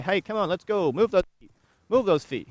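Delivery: urging the horse on. Shown as a demonstration. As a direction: urge those feet into motion, loud and high. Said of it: "put some effort and say".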